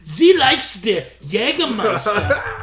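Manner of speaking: put-on German accent. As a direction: play a terrible German guy with a German accent. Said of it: "a terrible German guy".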